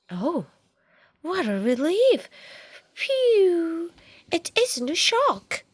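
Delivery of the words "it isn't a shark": ordinary delivery, nothing marked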